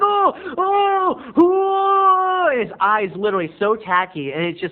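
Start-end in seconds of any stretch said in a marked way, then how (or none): 0.0-2.5 hammed-up pained scream. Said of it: "and he's like".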